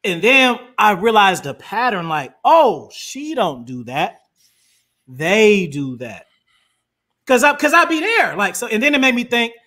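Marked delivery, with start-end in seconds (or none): 2.4-6.3 slow, drawn-out words, pitched lower; slow realization. Retold or imitated. Man recalling when he realized the pattern, slow and deliberate. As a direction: let it dawn on you slowly.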